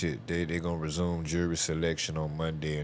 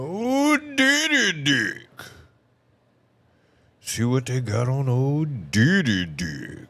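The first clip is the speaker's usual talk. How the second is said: mocking sing-song; 7 semitones higher, 6 dB louder, slower than usual. Mocking nickname for [name].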